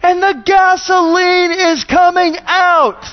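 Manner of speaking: high-pitched, stretched-out yell in mock old lady voice. Older lady keeps coming at the pastor over spraying gasoline, high yelling. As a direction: shriek every word slowly, imitating an angry old lady. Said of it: "she yells at me".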